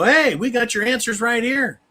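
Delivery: high, smug salesman pitch; sarcastic impression of officials offering answers; mocking government voice. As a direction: hand over the fix like a slick official. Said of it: "they offer their solution and go".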